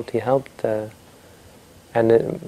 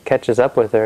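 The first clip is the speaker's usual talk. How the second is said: usual speaking voice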